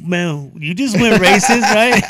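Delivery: high, loud laughing accusation; laughing tease. Real, not acted. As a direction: rib him while cracking up. Teasing friend over racist rant.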